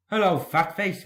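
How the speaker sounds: light cheeky engine voice; cheeky taunt. A narrator's reading. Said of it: "whistled Henry".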